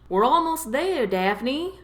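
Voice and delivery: calling out. A narrator's reading. Tom calls to Daphne over his shoulder, calling out. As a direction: call ahead to a passenger behind. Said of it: "Tom called to her over his shoulder".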